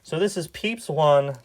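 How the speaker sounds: ordinary talk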